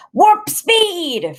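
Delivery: high ringing shout; rally the mighty beast forward. A narrator's reading. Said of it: "Freak is shouting".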